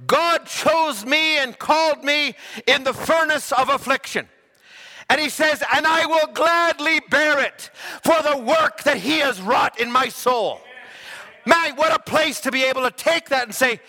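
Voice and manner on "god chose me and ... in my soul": fervent preaching. Acted. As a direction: proclaim it with full force. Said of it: "Charles Spurgeon said".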